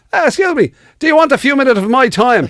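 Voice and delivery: chasing down a charity mugger, playfully; eager charity mugger. Retold or imitated. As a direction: stop a passer-by, far too keen. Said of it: "to go".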